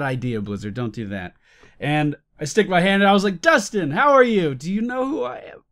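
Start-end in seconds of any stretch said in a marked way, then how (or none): 3.4-5.6 higher, hammed-up ego-trip greeting; mock egotistical. Greeting the game director, a mock self-important higher voice. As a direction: play the puffed-up celebrity asking if he's recognised. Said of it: "the most egotistical person".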